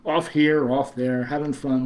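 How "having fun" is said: ordinary talk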